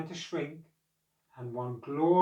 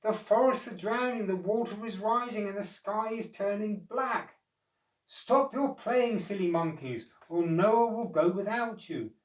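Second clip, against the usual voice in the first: urgent unicorns; 6 semitones higher than usual. A narrator's reading. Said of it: "called the unicorns".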